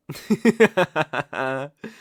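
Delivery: loud, gleeful villain laugh; a count's loud, pleased cackle; delighted laugh. Acted. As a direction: laugh out, charmed and hungry.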